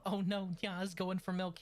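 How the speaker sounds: usual speaking voice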